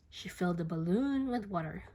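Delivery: ordinary delivery, nothing marked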